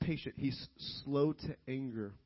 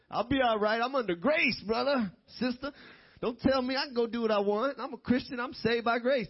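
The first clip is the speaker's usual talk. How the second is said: high, defiant mock protest; mock cocky believer; 8 semitones higher, 5 dB louder than usual. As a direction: protest in a higher, self-assured voice.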